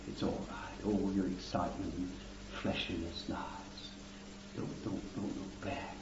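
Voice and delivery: soft breathy whisper, almost no voice; tender whisper. Acted. The Lamb comforting a tearful saint, whispered tenderly. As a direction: hush them gently like a child crying.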